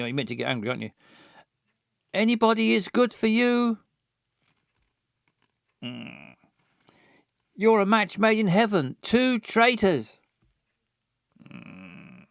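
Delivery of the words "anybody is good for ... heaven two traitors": bitter. Acted. Voicing bitter accusation.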